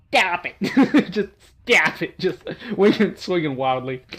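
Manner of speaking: laughing. Real, not acted. Laughing at own misreading.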